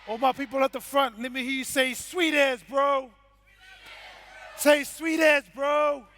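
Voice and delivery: loud crowd-hyping MC calls; hype call-out. Real, not acted. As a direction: pump up the crowd, shouting.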